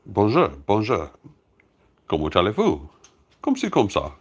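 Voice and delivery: sing-song schoolbook French with a put-on accent; hammed-up French. Shown as a demonstration. Showing viewers all his French, in a hammed-up accent. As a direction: play the phrasebook Frenchman, gently. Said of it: "that's as good as my French gets".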